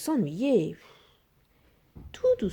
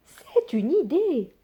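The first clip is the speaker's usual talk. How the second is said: put-on high, slow old woman agreeing; granny musing, higher and slow; 8 semitones higher, slower than usual. A narrator's reading. Old woman agreeing with her husband, high slow granny voice.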